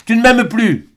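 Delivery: "m'aimes" cried high and loud, falling at the end; pained cry. A narrator's reading. Albine cries to Serge that he no longer loves her, loud and high. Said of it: "cria Albine".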